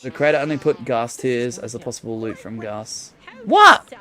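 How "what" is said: sudden high shocked what; surprised. Real, not acted. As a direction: blurt it out in disbelief. Shocked by quiz answer.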